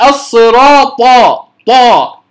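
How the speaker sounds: "الصراط، طا" with the ط voiced towards a heavy d. Shown as a demonstration. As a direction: mispronounce "طا" so it resembles "ضا". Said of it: "كأنها تشبه الضاد". (نطق "الصراط، طا" بطاء تشبه الضاد)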